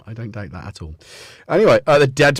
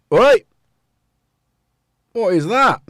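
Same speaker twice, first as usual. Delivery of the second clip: calling out; 9 semitones higher, 8 dB louder than usual. Real, not acted. Calling out at the cat.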